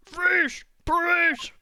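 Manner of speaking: loud high police order; cop shout. Acted. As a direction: bark it like a cop. Playing cop bursting in.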